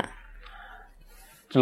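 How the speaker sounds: ordinary talk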